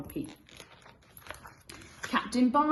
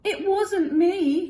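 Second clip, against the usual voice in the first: higher, louder, slow protest; Pete crying out; 12 semitones higher, 9 dB louder, much slower than usual. Acted. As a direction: plead your innocence loudly. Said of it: "cried Pete".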